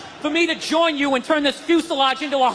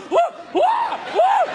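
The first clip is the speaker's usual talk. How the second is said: high falsetto "woo" yelps; bitchy frightened whoops. Retold or imitated. Mustachioed male in turbulence, high bitchy whoops. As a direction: imitate a grown man squealing like a child. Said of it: "acceptable out of a female or a child".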